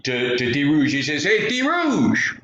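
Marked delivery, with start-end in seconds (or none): 1.2-2.3 high, drawn-out holler; far-off hail, pitched way up; calling out. Acted. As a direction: holler across the marsh in a high voice. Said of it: "So he yells out".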